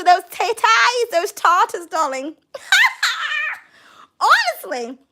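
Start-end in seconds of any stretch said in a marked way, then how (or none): 2.5-3.5 a high, squealing burst of laughter; shrieking laugh. Real, not acted. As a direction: let out a shrieking cackle. Laughs at own joke.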